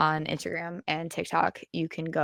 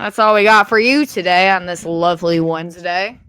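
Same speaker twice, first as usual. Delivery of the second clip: podcast outro; 7 dB louder than usual. Real, not acted. Outro announcement.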